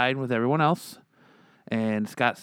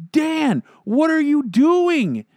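alarmed disbelief; 8 dB louder, much slower than usual. Retold or imitated. Narrator alarmed at his friend.

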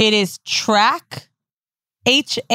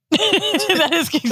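laughing through the words, higher and slower; 8 semitones higher, much slower than usual. Real, not acted. Calling out co-host for kink shaming, laughing. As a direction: draw it out through laughter, pitched up.

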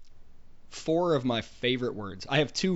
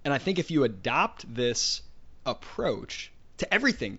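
normal talking voice

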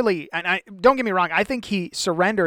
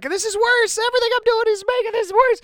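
much higher, fast panicky whine; panicked Moses; 3 dB louder, faster than usual. Retold or imitated. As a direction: squeal it like a frantic complainer. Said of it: "He's like".

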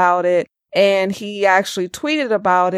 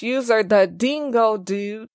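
ordinary talk, nothing marked

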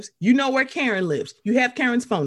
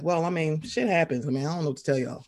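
lower, softer offhand shrug; shrugging; 5 semitones lower, 4 dB quieter, faster than usual. Retold or imitated. Her own reaction to the fight, low unbothered shrug. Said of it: "I was like".

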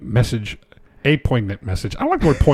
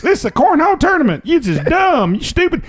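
high indignant rant; 12 semitones higher, faster than usual. Retold or imitated. Quoting indignant clip.

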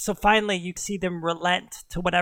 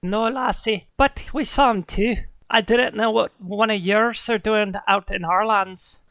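Irish huntress; 3 semitones higher, 3 dB louder than usual. Acted. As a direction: answer the lassie in an Irish lilt. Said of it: "slipping into Irish now".

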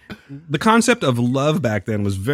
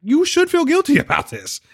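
angry outburst; 14 semitones higher than usual. Retold or imitated.

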